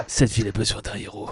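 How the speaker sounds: low, soft brooding hero; vigilante voice. Pretend. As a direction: growl it like a dark avenger. Vigilante line, low and quiet.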